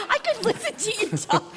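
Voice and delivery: talking through a high laugh. Real, not acted. Host to guest, laughing while praising his talk. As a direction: laugh the compliment out, delighted.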